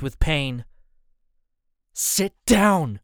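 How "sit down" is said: higher, louder command; firm order. A narrator's reading. Son firmly orders his hurt dad to sit. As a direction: take charge of your father. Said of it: "in a way I never".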